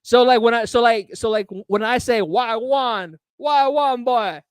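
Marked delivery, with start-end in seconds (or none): 2.2-4.4 amateur attempt at Jamaican patois; terrible Jamaican accent. Shown as a demonstration. Host showing off the accent his girlfriend mocks, bad Jamaican accent. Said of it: "she thinks that I do a terrible Jamaican accent".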